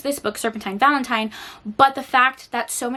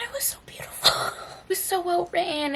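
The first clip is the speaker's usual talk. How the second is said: choked-up, high whispery squeak; on the verge of tears; 8 semitones higher, 5 dB quieter than usual. Real, not acted. Tearful reader gushing over a coming-out scene, choked up. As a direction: voice giving out with real emotion. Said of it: "gonna make me cry right now".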